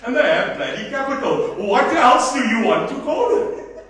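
exasperated rising rant; heated. Real, not acted. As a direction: argue hotly, half laughing. Heated argument addressed to Faye.

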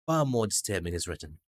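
ordinary talk, nothing marked

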